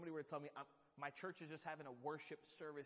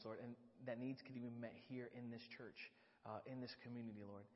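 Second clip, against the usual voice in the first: soft, low prayer voice; 3 semitones lower, 4 dB quieter than usual. Real, not acted. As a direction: pray earnestly.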